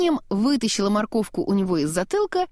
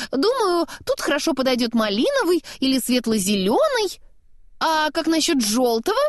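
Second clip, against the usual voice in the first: higher-pitched, lilting snowman; chatty snowman; 6 semitones higher than usual. A narrator's reading. Snowman suggesting colours to travellers, high chatty musing. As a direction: muse aloud in a playful, pitched-up character voice.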